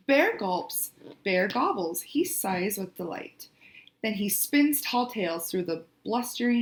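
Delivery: expressive picture-book reading; story narration. A narrator's reading. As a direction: read the story aloud to young children, drawing out key words.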